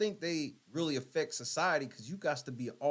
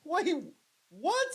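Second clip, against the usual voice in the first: high-pitched, cracking up; wheezing "what?" between laughs; laughing in disbelief; slower than usual. Real, not acted. Shocked by the clip, cracking up in disbelief.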